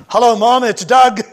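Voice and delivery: shouting into the phone. Retold or imitated. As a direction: call out so a hard-of-hearing mom can hear. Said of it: "her hearing's going".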